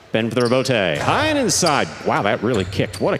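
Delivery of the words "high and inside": voice going up. Real, not acted. Commentator spots an unusual ball flight, voice going up. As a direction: pitch jumps on HIGH, then falls. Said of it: "you hear my voice go up".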